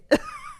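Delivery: short high laugh at her own joke; burst of laughter. Real, not acted. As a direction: crack up at the joke.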